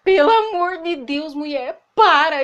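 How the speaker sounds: mock boyfriend losing patience; exasperated plea. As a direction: beg her to stop, at the end of your rope. Boyfriend begging drunk girlfriend stop.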